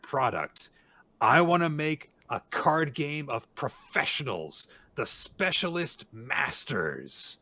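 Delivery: high, loud, rousing declaration; determined proclamation. Acted. Heroine asking for help with new product, loud and determined. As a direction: pitch it grandly, full of acted resolve.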